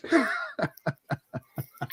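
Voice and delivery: high, soft cackling laugh; high gleeful laugh. Real, not acted. Host laughing at rivals, high gleeful cackle.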